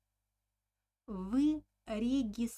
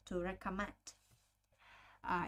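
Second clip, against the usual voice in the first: ordinary talk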